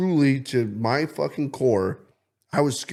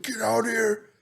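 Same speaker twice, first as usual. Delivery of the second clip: high, urgent mock panic; hammed-up terror, hurrying her out; panicked; 11 semitones higher than usual. Calling Jaden outside to see the lights, in high mock panic. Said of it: "straight panic mode".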